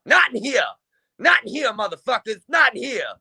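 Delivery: imitating Dope Fiend furiously ordering the moshers to stop; mad yelling. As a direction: yell like an outraged store boss. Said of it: "He's all mad".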